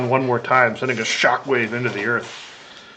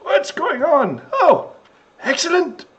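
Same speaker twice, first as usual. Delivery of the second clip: very high, excited squeaky character voice; revived little creature; 4 dB louder, slower than usual. Acted. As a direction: come to amazed, then rally everyone. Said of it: "he looks around and goes".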